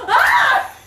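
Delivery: high loud shriek of fright; startled scream. Retold or imitated. Retelling a fright in the bathroom stall, shrieking. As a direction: yelp as if startled. Scared scream at naked lady.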